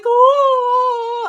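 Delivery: a high, loud, put-on little gasp of shock; mock shocked gasp. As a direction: squeak it out like the news just floored you. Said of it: "they're like".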